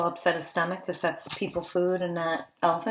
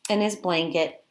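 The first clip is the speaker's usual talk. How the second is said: ordinary talk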